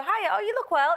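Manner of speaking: high, chirpy social hello; autopilot greeting. Acted. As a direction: toss off a high-pitched routine compliment. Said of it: "it's like autopilot".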